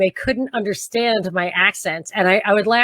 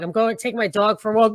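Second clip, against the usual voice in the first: usual speaking voice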